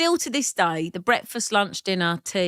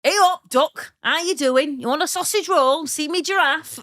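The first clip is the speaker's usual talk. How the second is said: a higher, cheery northern greeting; northern accent; 3 semitones higher than usual. Pretend. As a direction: lay on the northern accent, friendly. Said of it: "do your northern".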